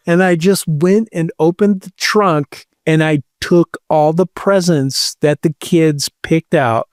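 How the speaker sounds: ordinary talk, nothing marked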